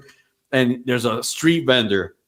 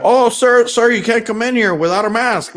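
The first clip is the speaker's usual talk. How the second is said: raised pitch, louder, prim store clerk voice; high mock clerk; 9 semitones higher, 4 dB louder than usual. Store clerk turning away the maskless guy, high prim voice. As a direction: scold him like a fussy clerk, high-pitched.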